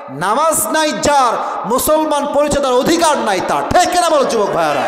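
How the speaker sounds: high, forceful sermon climax; rousing preaching. Real, not acted. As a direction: proclaim it to the whole congregation. Preacher drives home moral to crowd.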